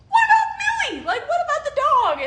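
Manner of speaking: very high, loud laughing yell; laughing shout. Retold or imitated. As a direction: holler after her through laughter. Calling after the girlfriend who fled.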